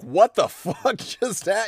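slow, stunned words broken by a laugh; laughing disbelief. Retold or imitated. To cohost, recalling seeing his dead grandpa, laughing disbelief. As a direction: drag it out in amazed laughter. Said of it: "And me being like".

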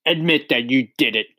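angry headmistress demanding a confession; roared demand. A narrator's reading. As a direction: press hard in a put-on harsh voice. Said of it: "roared the Trunchbull".

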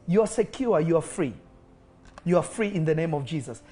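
normal talking voice

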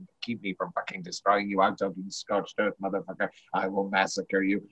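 low, softened threat, fading away; quiet menace. Acted. Quoting a film phone tirade to its end, low and menacing.

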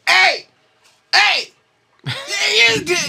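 high-pitched, loud mock old man calling out. Old man in a wheelchair calling out to the runner, high and loud. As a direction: yell like a grumpy old man flagging someone down. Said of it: "there's this old man".

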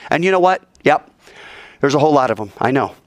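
ordinary talk

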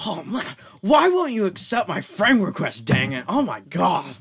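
high, loud frustrated surfer dude; angry surfer. Acted. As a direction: whine it like a furious surfer. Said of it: "He's a very angry surfer, dude".

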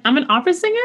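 "I'm an opera singer" lifting into a high questioning rise. Retold or imitated. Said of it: "I say it as a question".

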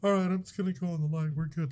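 imagined dead man calmly accepting his fate; mock resigned shrug. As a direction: shrug it off like it's nothing. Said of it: "why do I have to go".

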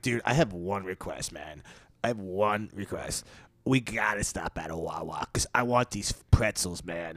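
low, soft, lazy dude voice; mock mumbling dude. Comedian imitating a passenger begging to stop for pretzels, low and soft. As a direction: mumble the request down low like a spaced-out guy. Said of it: "He's like".

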